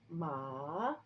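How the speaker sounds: "ma" rising like a question; second tone. Shown as a demonstration. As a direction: start "ma" low and sweep it upward. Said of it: "ma goes up".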